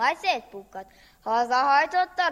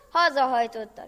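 plain reading voice

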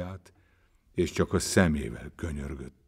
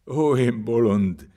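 loud, higher, slow lament; anguished cry; 5 semitones higher, 7 dB louder, slower than usual. A narrator's reading. Wretched man curses himself for his choice, crying out. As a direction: wail it out in self-reproach. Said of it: "kiáltotta nyomorult".

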